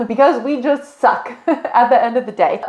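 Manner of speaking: real amused laughter breaking into the words; laughing. Real, not acted. Film reviewer joking that humanity failed its test, laughing.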